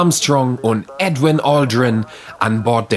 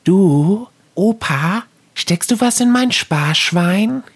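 put-on high, pleading little kid; child voice; 9 semitones higher than usual. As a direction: ask grandpa sweetly like a child. Child asking grandpa.

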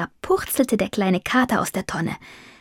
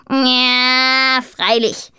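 long drawn-out, sceptical croak; deadpan raven sarcasm; dry; 4 semitones higher, much slower than usual. A narrator's reading. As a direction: draw it out in dry disbelief. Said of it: "sagte Jakob trocken".

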